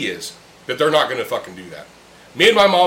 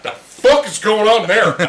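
higher, louder, slow disbelief; bewildered onlookers; 6 semitones higher, 5 dB louder, slower than usual. Retold or imitated. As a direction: imitate shocked strangers staring. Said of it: "they looked at him like".

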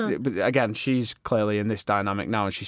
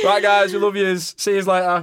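cheery sign-off; 9 semitones higher, 6 dB louder than usual. Real, not acted. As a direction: wave goodbye to listeners.

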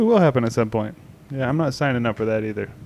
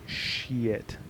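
muttered curse; 4 semitones lower, 11 dB quieter than usual. Retold or imitated. Retold reaction to blackout.

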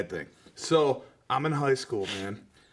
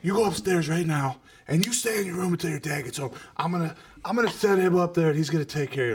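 imitating angry mom; 6 semitones higher, 4 dB louder, faster than usual. Mom scolding son in story.

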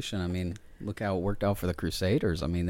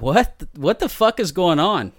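louder, higher retold disbelief; alarmed bafflement; 7 semitones higher, 7 dB louder than usual. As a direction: blurt it out, startled and baffled. Retold reaction to gasps behind door.

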